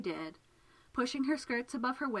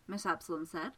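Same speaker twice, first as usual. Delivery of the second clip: usual speaking voice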